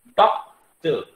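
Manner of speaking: Doctor in two separate beats, loud first beat; teacher sounding out doctor in syllables; syllable-by-syllable demo. Shown as a demonstration. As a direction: pause clearly between डॉक and टर in doctor. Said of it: "इसकी transcription ऐसे करेंगे".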